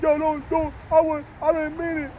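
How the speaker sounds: высокий испуганный голос оправдывающегося; pleading scared guy. Retold or imitated. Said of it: "He's like".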